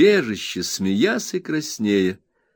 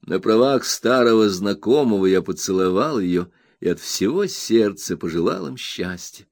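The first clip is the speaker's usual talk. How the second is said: usual speaking voice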